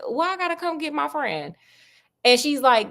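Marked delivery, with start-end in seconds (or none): 0.1-1.6 Watson's whiny question. Acted. Watson voiced, retold story.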